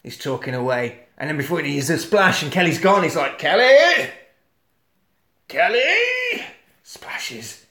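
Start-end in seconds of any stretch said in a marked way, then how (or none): 3.4-6.4 high, drawn-out call for a lost partner; acted searching call, rising in pitch. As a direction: call his name out into the distance. Acts out calling for missing partner.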